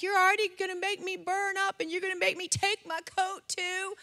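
imitating a reluctant kid, pitched high; whiny protest. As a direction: protest like a put-upon boy. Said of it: "he hemmed and hawed".

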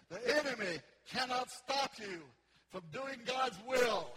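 loud, emphatic sermon delivery; full-force preaching. Real, not acted. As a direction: preach it with rising conviction, stretching the big promises.

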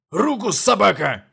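грубый пьяный окрик, громко; прикрикни на нищего, как пьяный пан; town drunk. A narrator's reading. Пьяный пан снова приказывает. (пьяный грубый окрик)

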